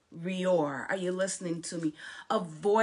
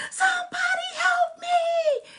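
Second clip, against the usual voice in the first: high-pitched, loud cry for help; acted shriek of someone in terror; panicked screaming; 8 dB louder than usual. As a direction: scream desperately for rescue. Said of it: "They were just screaming and just panicking".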